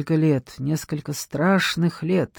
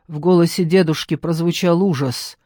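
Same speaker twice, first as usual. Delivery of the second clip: usual speaking voice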